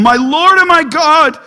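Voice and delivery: Thomas crying out, pitched high and loud. Retold or imitated. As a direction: cry it out like Thomas seeing the risen Jesus. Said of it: "He cries it out".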